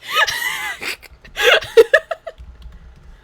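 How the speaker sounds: high, loud cry-laugh; shrieking laugh. Real, not acted. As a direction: laugh until it squeaks. Laughing after shushing.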